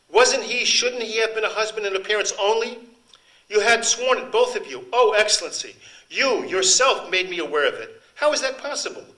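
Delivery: high, loud, desperate plea to the Marquis; impassioned woman pleading. Acted. Accused woman confronting the Marquis, high loud anguish. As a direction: throw the line at him, wounded and loud.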